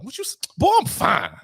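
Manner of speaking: high, sassy comeback; defensive sass. Retold or imitated. Dude clapping back at an insult, high and sassy. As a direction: snap back high and offended. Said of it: "somebody, "Man, you ugly as hell".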